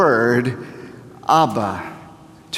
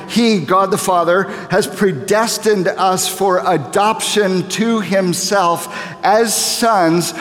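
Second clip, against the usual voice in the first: scripture preaching; 5 semitones higher than usual. Acted. Reading scripture, raised preaching voice.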